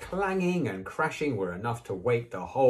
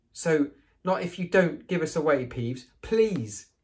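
pleading; 8 semitones higher, 4 dB louder than usual. Acted. Pleading with Peeves.

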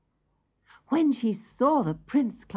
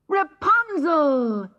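much higher, loud, slow cry of the name; joyful cry; 9 semitones higher, 6 dB louder, slower than usual. Acted. Prince crying out on hearing Rapunzel. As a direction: cry out her name in glad surprise. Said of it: "he cried".

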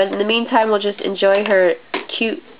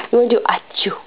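whispering; 3 semitones higher, faster than usual. Real, not acted. Whispered prompt to baby.

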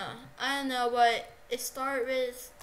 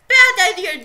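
higher, loud threat; loud warning; 5 semitones higher, 19 dB louder than usual. Real, not acted. Player warning someone in game, loud. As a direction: bark a warning.

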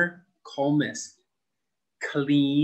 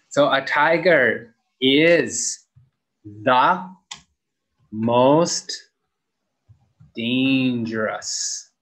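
slow model sentence; 4 dB louder, much slower than usual. Shown as a demonstration. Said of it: "teacher models the superlative sentence".

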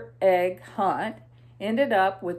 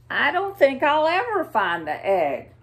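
a touch of a glum little bunny; mild mock grumble, pitched up; grumpy bunny; 5 semitones higher, 4 dB louder than usual. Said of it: "Bunny says grumly".

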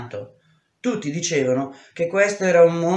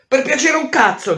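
loud, higher furious retort; angry shout; 9 semitones higher, 4 dB louder than usual. Acted. The girl scorns the boy's begging with a furious shout. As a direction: throw his plea back in his face, yelling.

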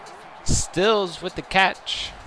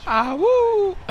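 high falsetto whoop; excited whoop; 5 dB louder than usual. Real, not acted. Celebrating during game.

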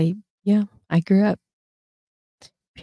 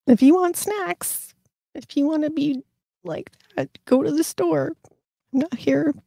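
dismissive; 9 semitones higher than usual. Retold or imitated. Mocking dismissal of kid.

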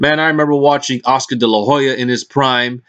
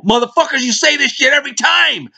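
exasperated rant; 13 semitones higher than usual. Real, not acted. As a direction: sneer at the fans who keep falling for it. Ranting at boxing fans.

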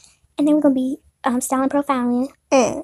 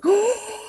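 gasp; 9 semitones higher than usual. Acted. Gasp at party invitation.

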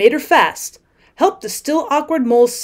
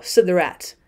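plain reading voice

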